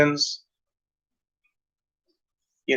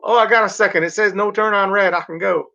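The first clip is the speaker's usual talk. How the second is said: higher mock-naive driver voice; 5 semitones higher than usual. Mocking the driver waiting at no turn on red.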